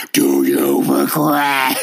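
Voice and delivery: high, slow, hammed-up menace on "Do you regret?"; terrible looming angel. Shown as a demonstration. Host shows how the angel should ask, slow and menacing. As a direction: stretch "Do you regret?" out slow and high like a huge eerie being. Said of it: "a more accurate way to read Mister Quinn's line".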